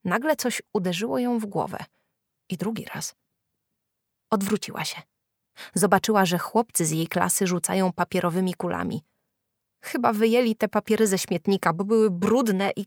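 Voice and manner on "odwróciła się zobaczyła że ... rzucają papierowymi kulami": ordinary delivery, nothing marked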